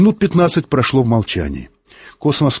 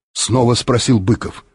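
usual speaking voice

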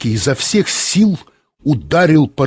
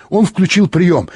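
ordinary delivery

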